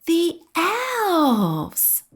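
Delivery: put-on a child spotting the elves; excited child cry. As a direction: point and cry out with delight. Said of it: "shouted one of the children".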